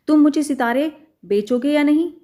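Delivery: angry mouse demands stars from the shopkeeper. Acted. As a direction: fire the question angrily. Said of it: "चूहे ने गुस्से से पूछा".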